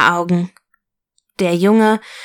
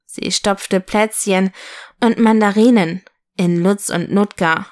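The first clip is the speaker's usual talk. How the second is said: ordinary delivery, nothing marked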